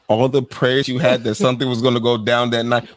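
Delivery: ordinary talk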